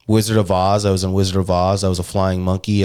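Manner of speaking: ordinary talk, nothing marked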